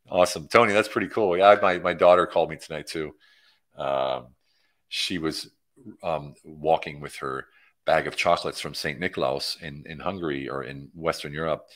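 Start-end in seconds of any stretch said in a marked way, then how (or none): none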